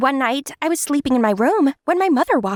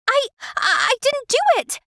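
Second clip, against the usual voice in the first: frightened stammer; 12 semitones higher, faster than usual. Acted. Summer stammers denial.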